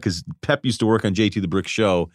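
usual speaking voice

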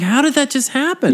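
half-mocking disbelief at yourself; retold disbelief. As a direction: replay the shock of finding the hole. Retold shock at the burned boot.

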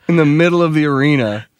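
ordinary talk

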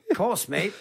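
put-on English accent, playfully matey. Shown as a demonstration. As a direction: show off a quick English accent. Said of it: "[name]'s pretty good".

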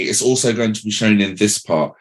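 ordinary talk, nothing marked

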